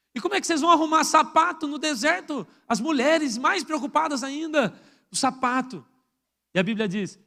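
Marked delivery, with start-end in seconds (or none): none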